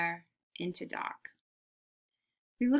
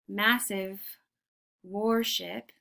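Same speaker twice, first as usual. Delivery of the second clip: ordinary delivery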